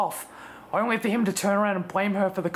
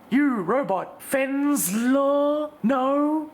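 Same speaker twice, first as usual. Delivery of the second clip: imitating the Doctor; 5 semitones higher, much slower than usual. Fourteen testing the robot on language, higher mock Doctor voice. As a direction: quiz the robot like the Doctor would.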